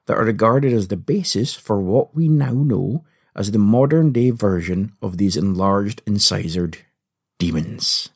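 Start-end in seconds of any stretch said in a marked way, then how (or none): none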